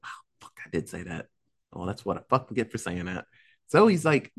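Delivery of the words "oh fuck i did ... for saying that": low, hushed self-reproach; muttered regret. Retold or imitated. Dad kicking himself for what he told his daughter, muttered. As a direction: mutter it to yourself, dropping low.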